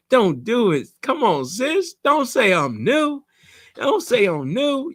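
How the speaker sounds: high, whiny begging voice, pitched well up; mock pleading newbie. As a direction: play a nervous newbie pleading with their sister. Student begging coach not to reveal.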